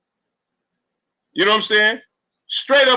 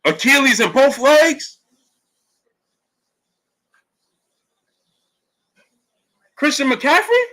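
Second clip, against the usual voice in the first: shocked disbelief; 6 semitones higher, slower than usual. Real, not acted. Shocked by injury news.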